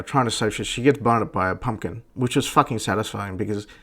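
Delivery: ordinary talk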